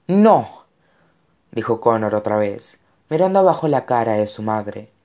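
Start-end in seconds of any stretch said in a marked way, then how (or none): 0.1-0.6 high, loud cry of no; anguished refusal. A narrator's reading. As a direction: refuse again, crying out. Boy refusing again in anguish. (negativa a gritos, aguda)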